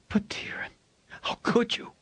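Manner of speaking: hushed reproachful whisper; wife whispering reproach at her husband. A narrator's reading. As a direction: breathe it out, voiceless and hurt. Mother reproaching husband privately.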